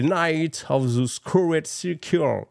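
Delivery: slow, drawn-out English with a heavy French accent. Real, not acted. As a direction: spell out the English title slowly, French accent on show. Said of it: "avec mon accent que vous adorez tous".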